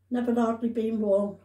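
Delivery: usual speaking voice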